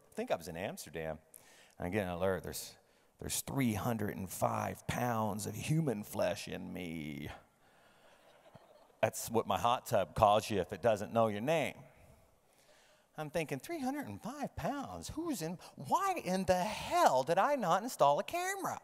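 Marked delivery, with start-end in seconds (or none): 3.4-7.3 hot tub alert. Acted. Reading out the hot tub's alert. 13.6-18.8 high-pitched incredulous questioning; mock alarmed disbelief. Retelling his shock at the alert.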